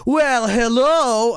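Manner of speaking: high, drawn-out mock-charming greeting; hammy cartoon flirt; Sonic. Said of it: "let me just put on the charm".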